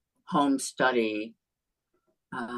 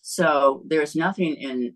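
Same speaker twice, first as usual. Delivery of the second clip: ordinary talk, nothing marked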